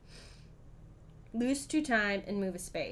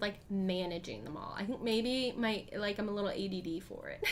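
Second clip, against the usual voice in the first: normal talking voice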